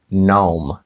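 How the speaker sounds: gnome said as "nohm"; correct pronunciation. Shown as a demonstration. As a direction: say gnome with a silent g. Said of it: "його ми правильно вимовимо як".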